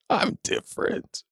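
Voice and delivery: slow breathy whisper. Pretend. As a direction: draw it out in a whisper. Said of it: "whispery, breathy voice".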